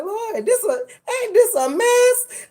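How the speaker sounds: high, slow, wailing lament; mock wailing. Retelling her hungover dismay at the news, high mock wail. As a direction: stretch it out like a mock cry.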